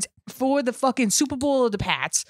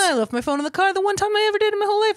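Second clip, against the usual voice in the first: rushed; 8 semitones higher, much faster than usual. Real, not acted. Animated fast anecdote.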